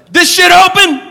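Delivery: high, loud yelled question; stranger's shout. Retold or imitated.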